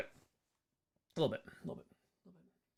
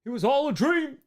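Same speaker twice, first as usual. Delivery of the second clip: quoting a line; 8 semitones higher, 5 dB louder than usual. Retold or imitated. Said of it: "it's not all a dream".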